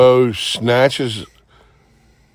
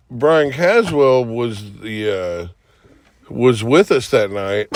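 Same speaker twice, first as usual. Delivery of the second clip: ordinary delivery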